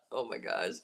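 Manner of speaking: ordinary talk, nothing marked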